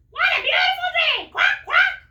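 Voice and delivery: very high, very loud quacking duck; squawking cartoon duck, high and loud; loud duck shout. Dottie voice continues.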